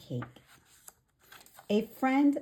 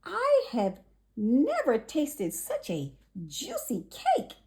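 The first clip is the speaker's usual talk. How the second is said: delighted; 7 semitones higher than usual. Acted. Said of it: "said Jenny".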